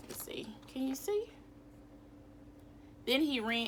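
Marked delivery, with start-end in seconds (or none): 0.1-1.3 soft unvoiced check-in; whisper. Real, not acted. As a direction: whisper it to the class. Teacher checks picture.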